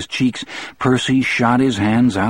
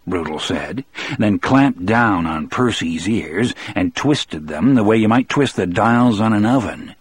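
usual speaking voice

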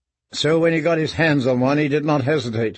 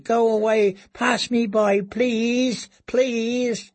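higher trembling plea; begging prayer; 9 semitones higher, much slower than usual. Acted. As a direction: beg desperately to be passed by. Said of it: "he prayed".

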